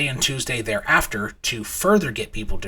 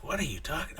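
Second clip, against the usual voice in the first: very soft, puzzled undertone; quiet retold bewilderment; hushed question; 3 semitones higher, 13 dB quieter than usual. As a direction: ask it under your breath. Retold hushed reply in restaurant.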